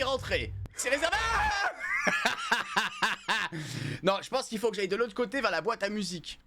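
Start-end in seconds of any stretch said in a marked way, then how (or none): 0.7-4.0 laughing. Real, not acted. Laughing at game situation.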